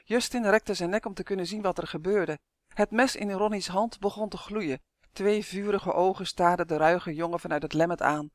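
ordinary delivery

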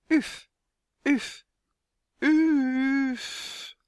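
high buzzing call, the last drawn out; high, insect-like hum. A narrator's reading. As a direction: buzz it high, stretching the final one. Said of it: "zoemde hij".

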